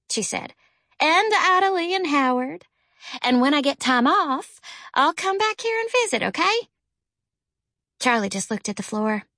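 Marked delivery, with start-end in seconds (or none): 1.0-6.7 put-on older sister voice. A narrator's reading. Jackie comforts Charlie.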